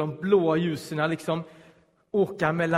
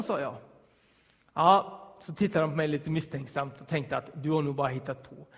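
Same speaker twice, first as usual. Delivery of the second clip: normal talking voice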